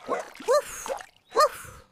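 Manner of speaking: short, high spoken woof; puppy woof. Acted. Thirsty puppy answers the child, high little woofs.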